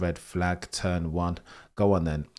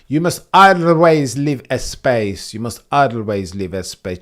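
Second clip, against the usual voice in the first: put-on loud, drawn-out Spanish-accented exclamation; mock Spanish accent; 6 semitones higher, 12 dB louder, slower than usual. Streamer impersonating a driver for chat, loud mock Spanish accent. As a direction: imitate an indignant Spanish driver. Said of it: "Accents apparently gonna become a thing".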